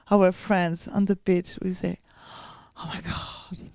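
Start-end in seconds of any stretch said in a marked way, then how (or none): 2.8-3.7 breathless, hushed wonder; awed whisper. Retold or imitated. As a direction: whisper it in awe. Awe in the crowd.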